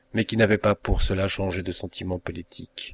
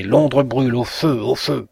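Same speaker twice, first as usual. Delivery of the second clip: raised, loud raving cry; frantic shout; 4 semitones higher, 4 dB louder than usual. A narrator's reading. Mad preacher screams fire to his neighbours. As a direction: yell it like a madman. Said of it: "éclatante que fût la voix de ce fou".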